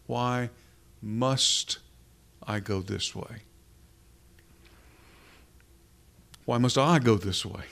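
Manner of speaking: Why, must, I, each word set apart by pauses; halting pauses between Why, must and I; slow broken emphasis. Shown as a demonstration. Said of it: "Put the emphasis where you will".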